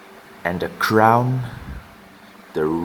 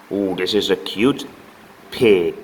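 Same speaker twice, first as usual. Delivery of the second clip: normal talking voice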